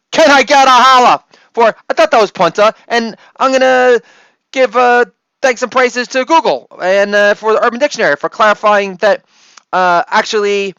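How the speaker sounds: hype holla. Acted. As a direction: pump up the crowd. Hype call to the audience.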